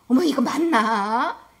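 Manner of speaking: loud, drawn-out startled doubt; unsure alarm. Retold or imitated. Preacher re-enacting getting lost at the station, startled doubt. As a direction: re-enact puzzled surprise, stretching the question. Acted alarm at broken signage.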